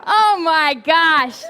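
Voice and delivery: slow, laughing through the words. Real, not acted. Laughing at the congregation's joke, real laughter. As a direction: gasp it out between laughs.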